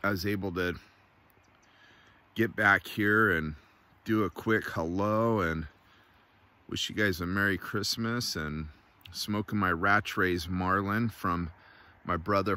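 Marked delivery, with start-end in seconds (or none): none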